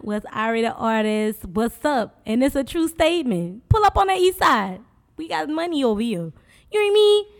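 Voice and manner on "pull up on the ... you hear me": loud high hype call; big, high radio hype; hype shout. Acted.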